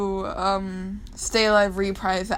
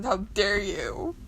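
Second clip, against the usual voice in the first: usual speaking voice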